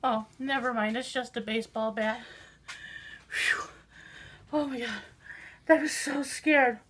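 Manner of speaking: ordinary talk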